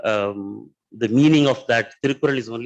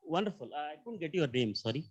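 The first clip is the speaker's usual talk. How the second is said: mic handed over; 12 dB quieter than usual. Real, not acted. Speaker without mic, faint.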